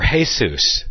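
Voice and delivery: "Jesus" said as "HAY-soos"; variant pronunciation. Shown as a demonstration. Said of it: "The same thing, just a little variation".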